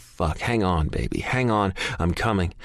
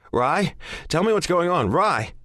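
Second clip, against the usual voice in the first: panicked; 10 semitones higher than usual. A narrator's reading. Panicked call into the phone.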